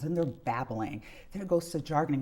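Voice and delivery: ordinary talk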